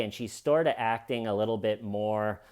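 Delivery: normal talking voice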